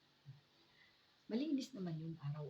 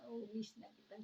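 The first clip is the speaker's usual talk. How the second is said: tearful father; 7 semitones higher than usual. Retold or imitated. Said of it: "sabi ni tatay".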